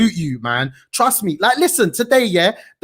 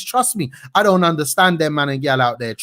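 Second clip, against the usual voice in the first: ordinary talk, nothing marked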